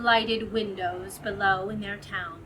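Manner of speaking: usual speaking voice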